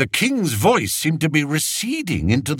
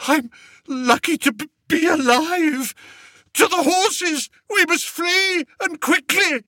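panicked urging. Acted. Panicked king urging flight.

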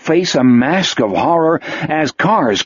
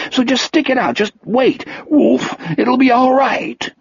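higher, pleading Wolf voice; Wolf voiced; 10 semitones higher than usual. Wolf soothing himself for Jack's sake, higher and pleading. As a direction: beg yourself to hold on, pitched up. Wolf's inner voice, coaxing.

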